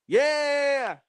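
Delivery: high, loud, drawn-out yeah; exuberant whoop. Pretend. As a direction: cap the song with a high shout. Whoop at end of song.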